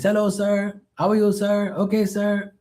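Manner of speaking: put-on high, polite Filipino-accented "sir" voice; imitating Filipino pool guy. Pool cleaner greeting Mark politely, in a high Filipino-accented voice. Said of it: "he's a Filipino".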